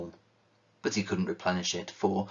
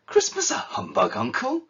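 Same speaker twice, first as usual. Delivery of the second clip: much higher, incredulous exclamation; shocked disbelief; 5 dB louder than usual. Acted. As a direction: nephew can't believe his ears, voice shooting up. Nephew shocked by Scrooge's word.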